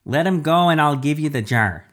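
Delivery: high, loud bargaining call; urgent demand. Acted. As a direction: call out an offer to the captor. Father bargains with captor.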